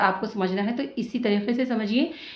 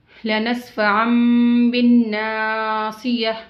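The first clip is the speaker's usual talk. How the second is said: slow, drawn-out tajweed recitation; measured Quranic recitation; 4 dB louder, much slower than usual. Shown as a demonstration. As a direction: chant each word slowly, stretching the vowels. Teacher recites Quran verse.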